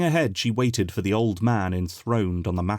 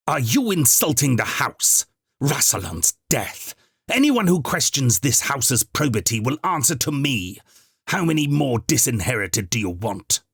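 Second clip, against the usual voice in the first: louder, higher furious tirade; raging rebuke; 5 semitones higher, 3 dB louder than usual. A narrator's reading. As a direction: lash out at the whole crowd. Housekeeper rages at crowd.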